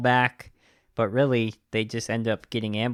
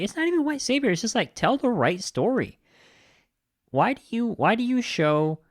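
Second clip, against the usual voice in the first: much higher, indignant protest; real frustrated rant, voice jumping up; exasperated outburst; 12 semitones higher than usual. Real, not acted. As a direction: fire off the complaint in disbelief. Reviewer's indignant rant on ending.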